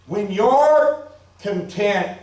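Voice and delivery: drawn out slowly, each word weighed; slow down to land the point; slow emphasis. Real, not acted. Preacher slowing to stress contentment to his church, slow.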